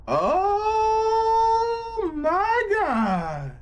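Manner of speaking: long high shocked "oh my God" wail; stunned viewer wailing at the screen; drawn-out wail. Real, not acted. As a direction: stretch it out in horror, way up high. Shocked reaction to gory scene.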